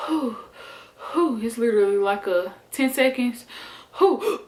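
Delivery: quick puffed-out breaths between pushes; mock the short recovery breaths in labor; breathing out. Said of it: "lean back after my ten seconds".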